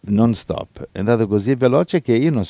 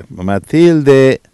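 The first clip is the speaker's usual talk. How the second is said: loud, higher joyful call to Matilde; excited exclamation; 3 semitones higher, 10 dB louder than usual. Real, not acted. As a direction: cheer her on warmly and loudly. Exclaims to Matilde after prayer. (esclamazione entusiasta, più forte e più acuta)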